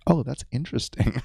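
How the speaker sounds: high-pitched polite "interesting"; put-on high social politeness; politely dubious neighbor. Voicing neighbor's polite reply.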